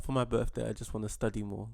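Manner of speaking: usual speaking voice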